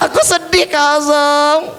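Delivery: high-pitched, mock-sad puppet voice; plaintive puppet confession. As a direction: complain sadly as a little puppet. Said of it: "Kamu sedih?".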